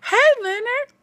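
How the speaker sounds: high mock villain greeting; [name]'s greeting. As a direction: greet them like a creepy villain. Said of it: "And T J says".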